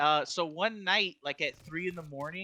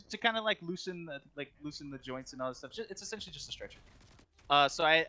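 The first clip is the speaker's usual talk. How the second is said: ordinary talk, nothing marked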